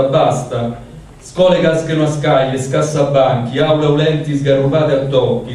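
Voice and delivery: poem recited in Roman dialect; roman accent. Acted. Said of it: "vado direttamente allora in dialetto".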